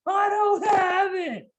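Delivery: very high, slow, sing-song shout; gloating daughter. Retold or imitated. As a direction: flex on him like a bratty kid. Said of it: "she's flexing on me like".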